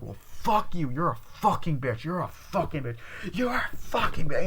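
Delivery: escalating insults. Retold or imitated. Retelling two women's nasty argument, escalating mock anger. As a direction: climb in pitch with each repeated insult.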